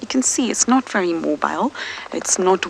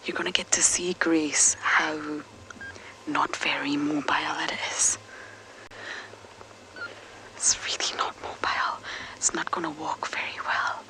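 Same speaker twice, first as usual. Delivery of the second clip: soft hushed whisper near the cub; 7 dB quieter than usual. Real, not acted. Presenter showing Grace the cub, whispering so as not to startle it. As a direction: whisper so the cub stays calm. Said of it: "I don't want to startle it".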